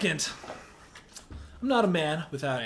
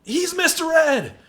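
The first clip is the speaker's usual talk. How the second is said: mock shout; 10 semitones higher, 7 dB louder, slower than usual. Real, not acted. Mocking comparison, shouted.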